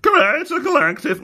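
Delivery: silly high-pitched garbled voice; funny voice. Pretend. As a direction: squawk it in a daft high voice. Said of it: "I'll read it in a funny voice".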